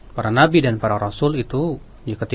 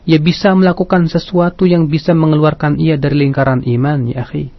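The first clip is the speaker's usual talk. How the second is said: preaching; 10 dB louder than usual. Acted. Radio sermon delivery.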